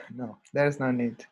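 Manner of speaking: ordinary talk, nothing marked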